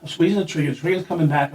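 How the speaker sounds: usual speaking voice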